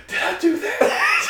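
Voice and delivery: high giggly feigned innocence; mock innocent, laughing. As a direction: play dumb while cracking up. Cop acting innocent, laughing.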